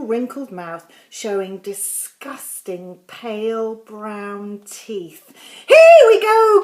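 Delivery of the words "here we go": loud, high call; excited cry. A narrator's reading. George giving Grandma the spoonful, excited cry. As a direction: cry out eagerly, pitched high. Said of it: "cried George".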